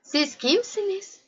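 high, soft, slow question; astonished. A narrator's reading. Little prince questions the roses, softly astonished. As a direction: ask in hushed surprise. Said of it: "Şaşkınlıkla".

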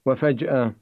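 plain reading voice

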